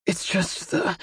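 soft, raw, unfinished explanation. A narrator's reading. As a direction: break off softly, voice worn from screaming. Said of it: "his voice raw".

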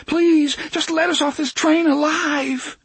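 high, loud, desperate begging; terrified plea. A narrator's reading. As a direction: beg for your life in a high child's voice. Terrified kids' faces plead.

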